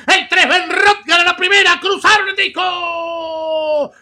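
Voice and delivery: yelling the finish at full pitch; excited shouting. Acted. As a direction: scream the photo finish home. Race finish, caller yelling.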